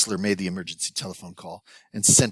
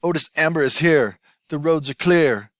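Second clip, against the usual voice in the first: loud, high, booming announcement; yelled; 8 semitones higher, 8 dB louder than usual. Acted. Otis bursts into the restaurant, yelling his arrival. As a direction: burst in and bellow it to the whole room. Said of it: "and yelled".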